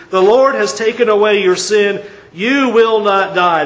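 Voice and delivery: booming declaration of mercy, slowing at the end; loud proclamation. Acted. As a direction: proclaim the pardon. Preacher proclaiming Nathan's pardon.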